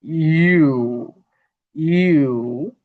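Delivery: ইউ drawn out long; long ইউ vowel; long sound. Shown as a demonstration. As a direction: hold ইউ long and even. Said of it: "long sound হবে".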